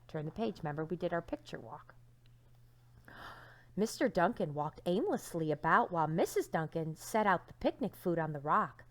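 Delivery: ordinary delivery, nothing marked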